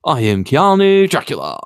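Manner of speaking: playful, booming Dracula bit; Keanu Dracula. Acted. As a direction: ham it up as a joke Dracula. Jokey Keanu Dracula impression.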